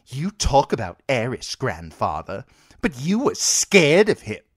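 put-on a child talking back to his grandfather; defiant boy king. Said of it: "Joff drew himself up defiantly".

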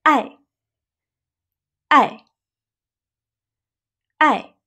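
爱 falling sharply from high. Shown as a demonstration. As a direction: drop 爱 decisively. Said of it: "A falling tone".

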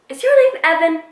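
friend's pointed question. Retold or imitated.

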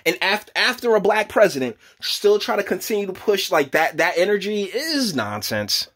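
ordinary talk

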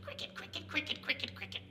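soft, quick cricket chirps; cricket chirping. Shown as a demonstration. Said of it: "If you can't make that sound".

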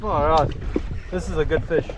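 ordinary delivery

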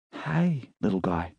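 put-on soft, gentle greeting to a little boy; tender to a child. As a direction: coo softly at a child. Dee to small child.

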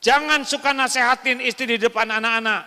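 loud, high and fast; forceful warning. Real, not acted. Preacher warning husbands not to lecture wives, loud and fast. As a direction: fire the warning out quickly.

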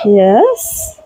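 thrilled little cheer; a happy high yes; excited high yes. Real, not acted. Happy all got hay.

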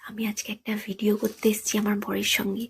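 soft whisper so he won't wake. Real, not acted. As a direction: hush it so nobody hears. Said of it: "আস্তে আস্তে কথা বলছি যাতে শুনতে না পায়".

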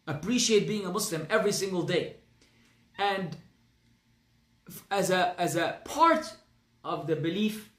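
usual speaking voice

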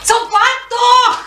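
high-pitched urgent call. Acted. Asking someone to wait for her, urgent high calling. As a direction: call after someone, stretching the words.

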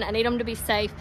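ordinary talk, nothing marked

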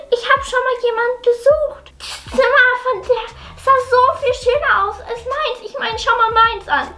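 a hint of a hurt twelve-year-old pleading with Mama; daughter voice.

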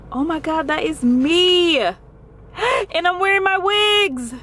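high, thrilled exclamation with a gasp; real excitement. Real, not acted. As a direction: squeal in amazement at seeing yourself. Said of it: "I'm so excited right now".

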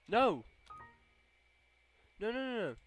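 high, loud alarmed yelps; panicked shouting. Real, not acted. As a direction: cry out in dismay at a mistake. Panics over a wrong click.

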